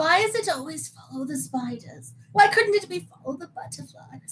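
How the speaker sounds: lightly put-on British accent, rising in pitch; a clumsy British accent doing Ron Weasley; bad British accent. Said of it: "It's my horrible British accent".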